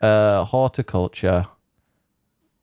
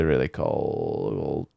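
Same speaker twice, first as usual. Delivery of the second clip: much lower, softer and slow; deadpan low slip; 8 semitones lower, 7 dB quieter, much slower than usual. Acted. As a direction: drop it low as a deliberate joke slip. Deliberate joke wrong word.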